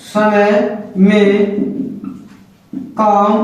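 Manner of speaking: each word dictated slowly with long pauses; slow dictation. Shown as a demonstration. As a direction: spell it out word by word for note-taking. Teacher dictating definition.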